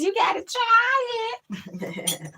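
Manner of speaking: very high, very slow quote; imitating the dude. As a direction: squeak out each word slowly. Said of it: "Like the dude says".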